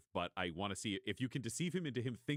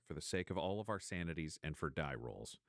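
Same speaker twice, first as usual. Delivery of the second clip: normal talking voice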